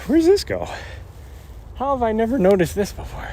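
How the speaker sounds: sudden high surprised question; puzzled jump up in pitch. Real, not acted. Finds something new on trail.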